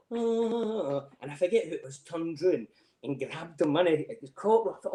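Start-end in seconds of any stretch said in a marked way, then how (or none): 0.1-1.1 high put-on groaning oh; mock groan. Mimicking Scouse outside work, high mock groan. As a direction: moan it like a sulking mate. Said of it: "and he went".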